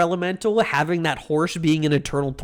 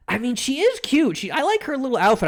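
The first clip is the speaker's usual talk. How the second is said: cute gushing; 8 semitones higher than usual. Real, not acted. Gushing over cute game character.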